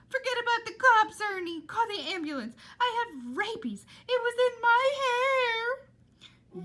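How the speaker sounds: high, very loud shrieking panic; hysterical Rita. Acted. Rita shrieking at Ernie, high and very loud. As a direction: shriek it, drawing out the end. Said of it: "Rita was right behind him".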